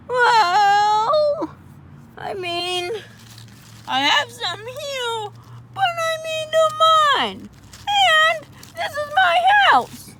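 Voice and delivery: very high squeaky voice guarding his things; squeaky possessive. Acted. Octopus refusing to share his tools, squeaky high voice. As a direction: squeak it out, clutching your stuff.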